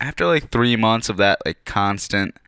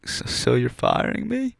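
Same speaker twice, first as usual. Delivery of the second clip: much higher, disbelieving question; shocked squeak; 12 semitones higher, 3 dB quieter than usual. Retold or imitated. Asking the owners if he's being fired, in a high shocked squeak.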